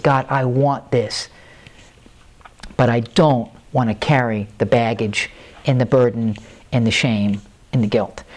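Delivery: weighty, halting confession to God; retold earnest prayer. Retells his prayer to God.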